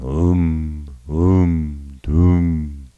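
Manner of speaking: long, droning "um" chant; seance humming. A narrator's reading. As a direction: hum each syllable like a seance circle. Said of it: "All were humming".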